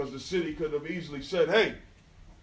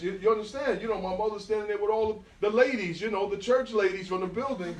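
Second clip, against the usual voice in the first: ordinary talk, nothing marked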